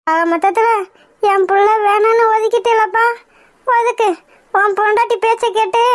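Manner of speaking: ordinary delivery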